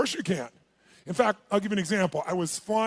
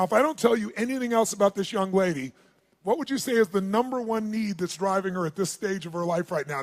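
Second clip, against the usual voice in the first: usual speaking voice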